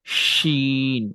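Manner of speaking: shin said with a sh sound; letter name শিন, sh not s; Arabic letter shin. Shown as a demonstration. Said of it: "সেটা হচ্ছে উচ্চারণ শিন".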